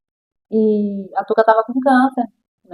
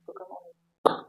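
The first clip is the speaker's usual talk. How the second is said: slow, nearly voiceless murmur; soft, drawn-out whisper of realisation; hushed dread; 17 dB quieter, slower than usual. Retold or imitated. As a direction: let the bad news out under your breath. Realising friend has died, retold.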